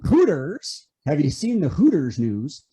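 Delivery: ordinary delivery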